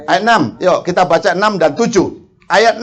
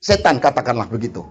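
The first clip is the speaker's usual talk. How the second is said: ordinary talk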